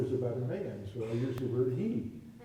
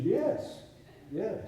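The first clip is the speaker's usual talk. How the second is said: loud, high-pitched emphatic agreement; emphatic mock insistence; insistent retold yes; 9 semitones higher, 5 dB louder than usual. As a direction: imitate someone insisting, pitch jumping up. Acting her insistent, upset answer.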